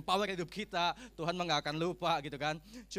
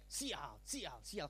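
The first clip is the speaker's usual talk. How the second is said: mildly acted sulky cursing, softer; cursing bad luck; 5 semitones lower, 9 dB quieter, much slower than usual. Preacher imitates the old ugly way of talking, cursing bad luck. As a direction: grumble it in mock disgust. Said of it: "Ngomongnya jelek".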